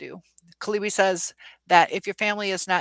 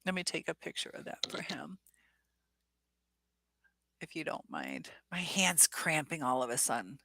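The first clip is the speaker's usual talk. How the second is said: real whisper while fiddling with the phone; hushed, breathy aside, voice dropped away; 3 semitones lower, 11 dB quieter than usual. Real, not acted. Streamer whispers to herself while snapping a photo, whispering.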